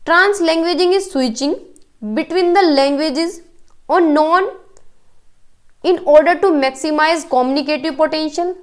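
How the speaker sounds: reading the English option out slowly, word by word; slow read-aloud. Shown as a demonstration. Teacher slowly reading the option to exam students. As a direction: dictate each word so students follow.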